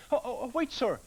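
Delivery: high, hurried little tree man; eager tree man. Acted. Tree man calls the woodcutter back, high and eager. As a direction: call him back in a small high voice. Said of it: "said the tree man".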